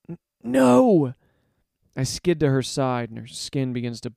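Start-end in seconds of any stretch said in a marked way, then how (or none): 0.1-1.1 stammered, high, loud cry; anguished shout. Acted. Narrator sees Ro breathe poison, shouting desperately.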